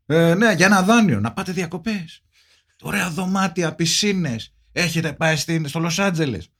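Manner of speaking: high-pitched mock bank telemarketer; pleading loan caller. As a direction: coax them hard into taking the loan. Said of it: "σε παρακαλούσαν να πάρεις δάνειο".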